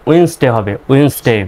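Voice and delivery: ওয়েডনেসডে shortened to "wins-de". Shown as a demonstration. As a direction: say ওয়েডনেসডে in two syllables, silent d. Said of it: "আরও যদি সঠিকভাবে করেন".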